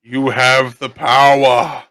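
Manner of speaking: slow booming heroic proclamation; He-Man voice. Pretend. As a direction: declare it like He-Man. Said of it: "Okay, He-Man, calm down".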